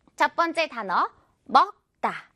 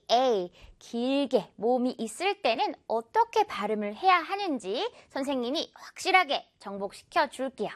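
ordinary talk, nothing marked

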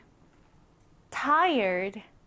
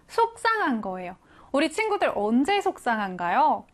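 usual speaking voice

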